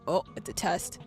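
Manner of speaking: normal talking voice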